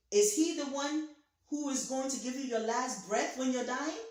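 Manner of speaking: normal talking voice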